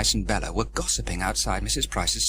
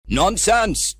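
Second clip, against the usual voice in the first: loud, higher dismissive shout; 9 semitones higher, 7 dB louder than usual. Acted. Trevor running with the kite, shouting back loudly. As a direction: wave off the worry loudly. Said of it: "shouted Trevor".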